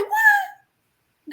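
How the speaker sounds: high loud laughing outburst; shocked. Retold or imitated. Wife reading scripture, loud surprised shout. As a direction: burst out in disbelief. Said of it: "I said".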